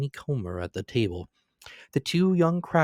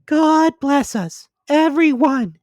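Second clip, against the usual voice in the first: put-on much higher, louder, slow child's voice; Tiny Tim, the little son, piping high and slow; small child's voice; 9 dB louder, slower than usual. A narrator's reading. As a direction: lift it into a small boy's piping voice. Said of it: "said Tiny Tim".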